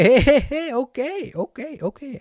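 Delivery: very high hey hey hey, okays softening; high energetic hey to a friend upset you're late; matching energy. Shown as a demonstration. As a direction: jump up high to meet a heated friend. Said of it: "a very different way of saying".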